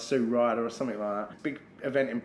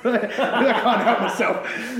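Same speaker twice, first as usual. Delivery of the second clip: slow laughing confession, higher and louder; 9 semitones higher, 6 dB louder, much slower than usual. Real, not acted. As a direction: laugh it out at your own cheek. Laughs at his own cheek.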